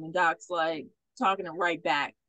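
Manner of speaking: ordinary talk, nothing marked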